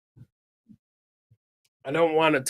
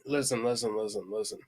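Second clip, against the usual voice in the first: hushed repeated listen; 6 semitones lower, 9 dB quieter, faster than usual. Real, not acted. Trying to get attention quietly.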